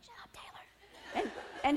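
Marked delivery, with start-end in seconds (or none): 0.0-0.6 whispered shut up; whispered teasing to a friend. Retold or imitated. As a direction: hiss it under your breath.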